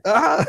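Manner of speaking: high-pitched panicked "ah" scream; imitated yell. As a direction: let out a mock scream, way up high. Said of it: "I'm yelling".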